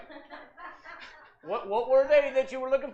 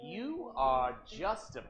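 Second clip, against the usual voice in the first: slow, low lightly voiced old man; drawn-out weary old man; 11 semitones lower, 9 dB quieter, much slower than usual. Old man pretend play voice.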